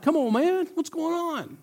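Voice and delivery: David moaning about his neighbour's blessing; whiny David. Retold or imitated. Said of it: "he's like".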